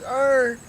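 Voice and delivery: high car-engine growl; imitated engine revving. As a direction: mimic a car gunning it.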